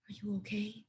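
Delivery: robot mother hushing her upset son; whispered. A narrator's reading. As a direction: murmur it close to his ear. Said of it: "she whispered in his ear".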